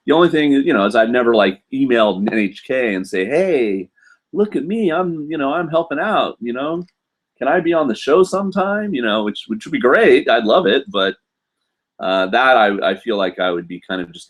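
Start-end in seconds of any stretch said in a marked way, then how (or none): 3.3-8.9 high, hammed-up begging, a touch of self-mockery; mock eager plea. Vlogger mock-pleading with NHK for a spot on their show, high and hammy. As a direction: play the pushy fan angling for a TV spot.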